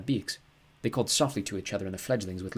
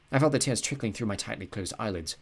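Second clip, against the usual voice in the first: plain reading voice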